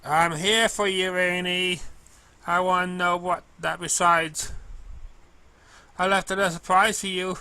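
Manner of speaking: a sweet clumsy mail pony, bright and keen; girlish cartoon pony voice, slowed and earnest; Derpy's voice.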